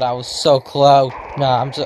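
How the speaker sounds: ordinary talk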